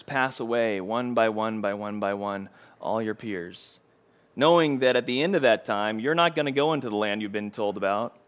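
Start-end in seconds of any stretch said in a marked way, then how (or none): none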